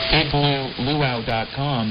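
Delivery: ordinary talk, nothing marked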